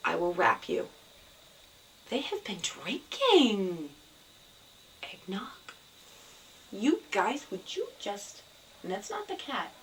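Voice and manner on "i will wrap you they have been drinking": normal talking voice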